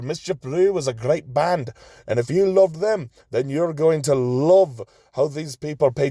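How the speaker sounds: high, hammed-up Irish brogue reading the gig list; a jolly Irish accent for Saint Patrick's Day. Host reading Saint Patrick's Day shows to listeners, in a high hammed-up Irish accent. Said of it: "I hope that that was a passable Irish accent".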